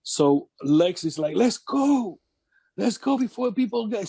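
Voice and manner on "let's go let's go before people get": higher, hurried urging to leave; pushing his buddy to get moving; impatient Lex Luger. Retold or imitated. Lex Luger hurrying Owen out of the arena, impatient.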